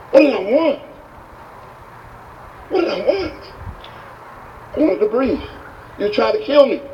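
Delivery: high-pitched, indignant echoing; acted exasperated high voice; incredulous outrage. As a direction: throw the question back in disbelief. Furious man answers guru's question.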